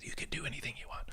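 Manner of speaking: fast, soft whisper. Real, not acted. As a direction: whisper it quickly. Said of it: "Why are we whispering?".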